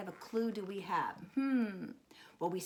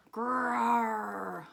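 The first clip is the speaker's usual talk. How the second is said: drawn-out rolling growl; playful storybook bear growl. Acted. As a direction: growl like a big brown bear. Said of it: "and it growls".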